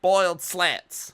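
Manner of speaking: high, loud, slow silly shout; loud mock shout. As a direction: belt it out slowly and shrill. Mock parent shouting menu.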